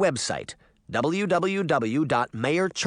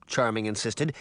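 ordinary delivery, nothing marked

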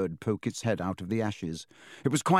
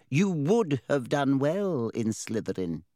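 slow, higher small hat voice; talking hat; 4 semitones higher, slower than usual. A narrator's reading. Hat tells Harry about Slytherin, in slow hat voice. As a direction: draw out the verdict as the talking hat.

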